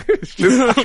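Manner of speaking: high, loud burst of laughter; laughing hard. Real, not acted. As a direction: crack up at the joke. Cracking up at the picture.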